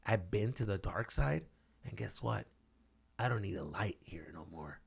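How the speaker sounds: hushed, brooding menace; menacing TV antihero. Acted. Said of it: "you know what he said?".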